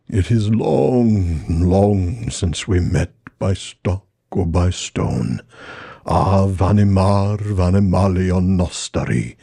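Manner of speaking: very slow, solemn Treebeard voice. Acted. Treebeard bidding farewell to Celeborn and Galadriel, slow and reverent.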